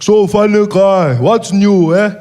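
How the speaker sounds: put-on slow, loud, heavy-accented; Malema impression. Malema greeting the comedian, slow and loud. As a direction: drag each word, teasing. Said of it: "he's like".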